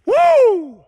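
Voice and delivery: high, loud "woo"; one long ringing whoop. Real, not acted. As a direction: let out a high whoop into the trees. Cheering at sunshine, hearing echo.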